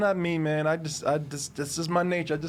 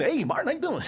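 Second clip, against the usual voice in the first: fake salesman greeting; 7 semitones higher than usual. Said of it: "if y'all wanna be natural".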